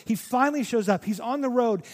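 normal talking voice

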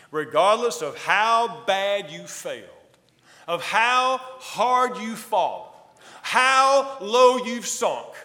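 Jesus sending word to Peter, passionate build; impassioned preaching. Acted. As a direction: preach it with rising force.

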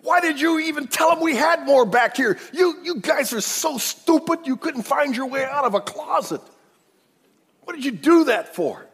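high, loud angry berating; 1 furious scolding. Retold or imitated. Jacob berating his sons as fools, high angry shout. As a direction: tear into them like a fed-up father. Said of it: "He goes".

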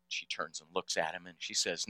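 ordinary talk, nothing marked

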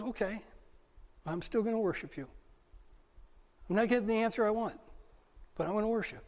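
soft, quick, resigned determination; quietly decide to keep worshipping; woman answering Jesus, softly resolved; quiet resolve. Acted.

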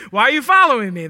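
a hammed-up startled "why follow me"; a joking Jesus, playfully alarmed at being followed; mock alarmed question. Jesus voiced as suspicious, joking.

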